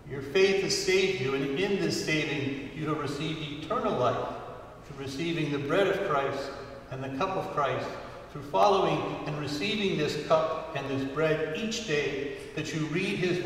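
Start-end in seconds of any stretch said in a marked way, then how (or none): none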